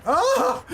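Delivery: very high, loud yelp; startled scream. Real, not acted. Gamer caught off guard mid-match, startled high scream. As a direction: scream out in sudden fright.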